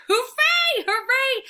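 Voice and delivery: very high, loud hooray; joyful cheer. A narrator's reading. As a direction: shout for joy. Dog excited by dog park.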